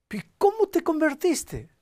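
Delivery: high, loud and slow amazed asking; stunned disbelief at the change; astonished question. Retold or imitated. Pastor asks the former abuser how he converted, astonished.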